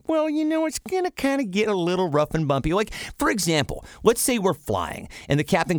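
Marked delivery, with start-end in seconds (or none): 0.1-2.7 mock gentle sugarcoated warning; gentle sugarcoating. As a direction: coo it like a soothing newsreader. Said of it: "somebody to kinda be like".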